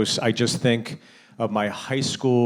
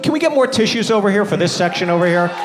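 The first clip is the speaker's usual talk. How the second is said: high-pitched pretend rant; mock exasperated outburst; 10 semitones higher, faster than usual. Comic berates the audience section, high mock outburst. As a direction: complain in hammed-up high hysteria.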